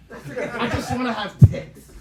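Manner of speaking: ordinary talk, nothing marked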